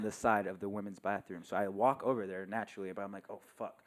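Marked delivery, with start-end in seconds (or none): none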